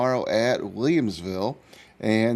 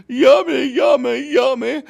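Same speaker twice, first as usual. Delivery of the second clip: high, loud hen voice; sing-song yummy of a greedy little hen; delighted hen; 8 dB louder than usual. Acted.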